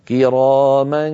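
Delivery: كراما stretched slowly with a nasal lead-in; slow recitation demo of كراما; ikhfa demo. Shown as a demonstration. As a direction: glide into كراما without pressing. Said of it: "كما نرى على اللوحة".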